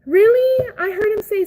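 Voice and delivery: high, loud squeal of disbelief; surprised. Real, not acted. Reacting to hearing the name, high surprised squeal. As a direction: shoot up in surprise.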